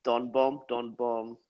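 usual speaking voice

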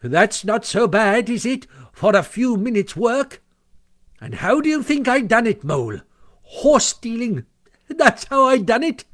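high, loud, cocky showing-off; boastful swagger. A narrator's reading. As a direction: crow proudly to an impressed friend. Toad brags to Mole.

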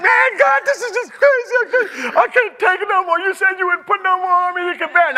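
high, loud desperate plea; crying out in despair, voice way up; crying out to God. Retold or imitated. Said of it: "I'm like".

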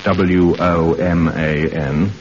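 slow, each letter said apart; spelling out a word so the radio operator can't hear; spelled out letter by letter. Pretend. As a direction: spell the word out slowly, like a secret code. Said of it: "Not every word, Sid, only the important ones".

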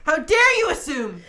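mock offence. Mocking an offended stereotype.

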